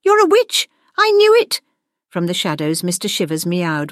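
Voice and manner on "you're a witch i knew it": very high, excited discovery; exclaimed realization. A narrator's reading. Gwendolyn finding out her teacher is a witch, high exclamation. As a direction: blurt it out high, louder on the end. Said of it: "her voice echoing with realization".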